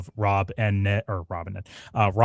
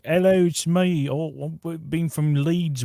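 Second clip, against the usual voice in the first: higher, slow put-on northern English accent; bad Leeds accent; 7 semitones higher, slower than usual. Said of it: "whatever the way they speak".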